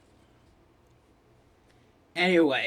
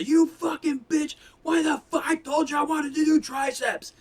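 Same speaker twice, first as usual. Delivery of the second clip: fake gym fight yell; 12 semitones higher than usual. Fake-fighting his gym buddy to prank the room, mock furious yelling. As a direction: yell at her like a furious boyfriend. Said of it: "just start yelling at each other".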